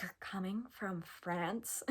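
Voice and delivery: gasp, then mock-whisper slowly in disbelief; shocked whisper. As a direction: imitate a stunned, drawn-out whisper. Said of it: "She was, like, shocked".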